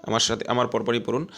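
ordinary talk

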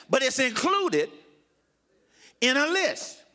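high, slow, ringing pulpit delivery; emphatic preaching. Real, not acted. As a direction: hammer the point home phrase by phrase. Repeats the point forcefully.

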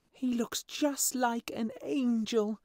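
put-on high, slow, wondering child voice; charity children. A narrator's reading. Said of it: "said the charity children".

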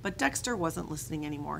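ordinary delivery, nothing marked

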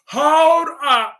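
indignant. Real, not acted. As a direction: stop them cold. Renewed protest.

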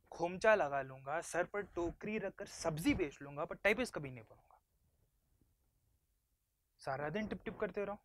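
loud, indignant refusal; son's stubborn outburst; defiant protest. Acted. Son defies father's career plan.

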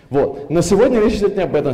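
normal talking voice

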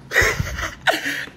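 squeaky burst of laughter; high giggle. Real, not acted. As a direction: crack up at your own bit. Laughing at his bit.

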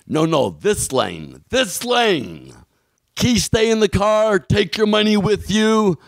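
mock a border guard yelling directions; imitating a yelling guard. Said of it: "this man is yelling at me".

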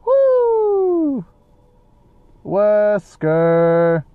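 high woo, then a drawn-out call; playful hollering. Pretend. Player searching the mansion for Wesker, playful hollering. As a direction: holler it long to someone out of sight.

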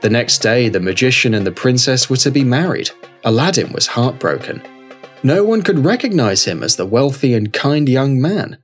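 ordinary delivery

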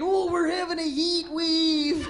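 slow, stretched Minnesotan vowels; mock a cheery Minnesotan, drawing it out; drawn-out Minnesotan impression; Minnesotan accent. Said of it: "Well, Minnesotans".

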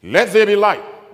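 high, slow, booming divine order; shouted command. Acted. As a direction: call it out as God's command. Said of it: "was a command".

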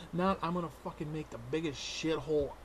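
usual speaking voice

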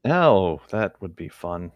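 ordinary talk

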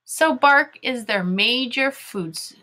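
slow, deliberate read-along; measured classroom reading; reading to children. Shown as a demonstration. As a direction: read word by word so pupils can follow.

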